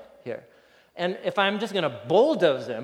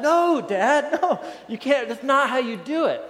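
put-on higher, laughing little kid protesting; laughing toddler voice; 8 semitones higher, 4 dB louder than usual. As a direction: play the stubborn toddler, higher and through laughter. Toddler protests dad's building.

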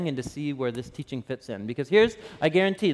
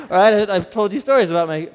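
ordinary talk, nothing marked

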